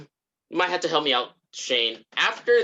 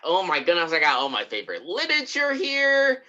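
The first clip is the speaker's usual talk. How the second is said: delighted mock gushing; 3 semitones higher than usual. Acting out George among his favorite books, delighted. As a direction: gush with pitch swooping up at the end.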